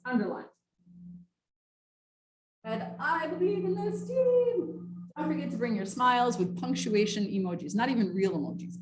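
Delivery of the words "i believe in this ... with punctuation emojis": sugary mock email voice. Instructor mocking a gushing email to her class, higher and sugary.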